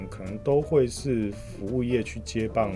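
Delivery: ordinary talk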